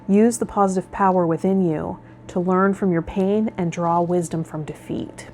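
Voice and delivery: normal talking voice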